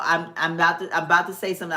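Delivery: ordinary talk, nothing marked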